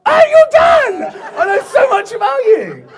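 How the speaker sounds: very high, loud squeal of recognition; gushing mock-fan shout; excited greeting. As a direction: shriek it like you've met a celebrity. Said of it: "she's gonna be like".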